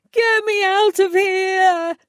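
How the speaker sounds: high, loud, slow frantic cry; fever-pitch panic. A narrator's reading. Trapped fairy demanding release, high frantic cry. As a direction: shriek it out in full panic. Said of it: "He was reaching fever pitch".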